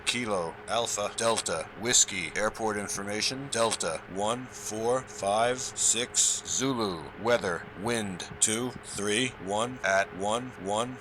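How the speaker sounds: ordinary talk, nothing marked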